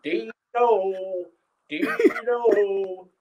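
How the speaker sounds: slow, high drawn-out call; hammed-up manager hollering over the green; mock calling across. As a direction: stretch it out across the putting green.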